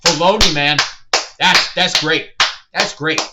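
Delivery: loud, fawning fan praise; gushing fan. Retold or imitated. A fan at the screening loudly gushing over the creator. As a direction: hype it up like an adoring fan in the crowd. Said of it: "And somebody said".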